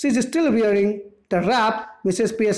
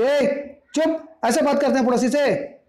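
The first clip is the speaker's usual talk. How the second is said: higher, hammed-up scolding yell; mother scolding, pitched up; mock shout; 5 semitones higher than usual. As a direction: shush the daughter with a mild yell. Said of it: "चिल्ला के बोली".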